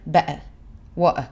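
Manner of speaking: "better, water" with a glottal stop instead of t; dropped t. Shown as a demonstration. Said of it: "ils prononcent pas du tout le T". (« better, water » sans prononcer le t)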